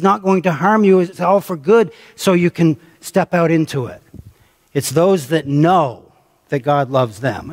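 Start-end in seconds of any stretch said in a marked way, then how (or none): 4.7-7.5 "know" said slow and weighted, the whole line drawn out; preacher underlining "know"; stress on know. Shown as a demonstration. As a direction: lean on "know" and slow right down. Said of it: "I underline the word know there".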